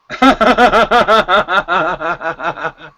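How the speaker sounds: long real laugh; hearty laugh. Real, not acted. Laughing at the others.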